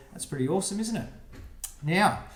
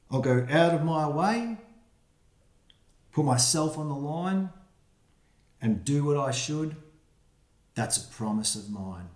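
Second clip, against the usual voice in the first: put-on reading to children. Reads rhyming picture book aloud.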